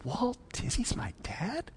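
hushed, high, disbelieving question; stunned little girl. Retold or imitated. Little girl learns who her dad is, stunned whisper. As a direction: whisper it like an amazed child.

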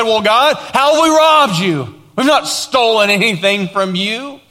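high, loud mock-innocent protest; flippant retort. The people denying they robbed God, imitated flippant protest. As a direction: answer back in imitated indignant flippancy. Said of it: "they flippantly said".

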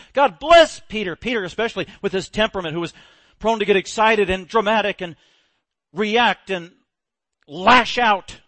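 preacher stirring up the congregation; full-force preaching. Acted. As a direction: drive it home from the pulpit.

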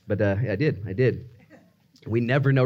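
ordinary delivery

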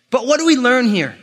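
normal talking voice

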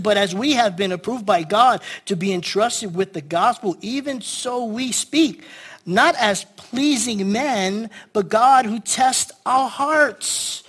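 slow emphatic Bible reading; scripture reading. Acted. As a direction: read each phrase slowly and grandly.

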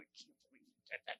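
a fast breathless whisper from the crowd; shocked whisper. Retold or imitated. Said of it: "one of the guy in the crowd just going".

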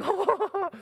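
a sudden high yelp from icy water; high startled "oh" as freezing water hits; cold shock gasp. Retold or imitated. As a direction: jump at the cold drip down the back. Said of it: "you're like".

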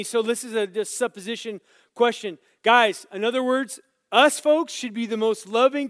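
loud, high-pitched sermon delivery; full-force preaching. Real, not acted. Preacher urging the congregation to love one another, preaching forcefully. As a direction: preach it out loud to the whole room.

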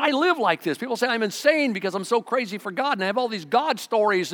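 high, loud, fired-up testimony; bursting with zeal for God; excited Paul. Retold or imitated. Paul voiced as crazy for God.

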